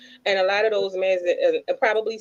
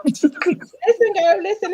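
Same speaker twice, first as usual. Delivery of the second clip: excited high call; 10 semitones higher, much slower than usual. Real, not acted. Cutting off friend playfully.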